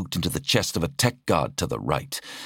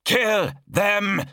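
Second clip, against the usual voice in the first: much higher battle yell; yelled order. Acted. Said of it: "one of the attacking Skitari yelled".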